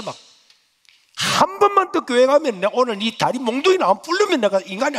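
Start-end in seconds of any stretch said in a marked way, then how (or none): 1.1-5.0 high, loud threat; threatening mother. Retold or imitated. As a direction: threaten to break his legs. (높고 크게 위협하는 어머니)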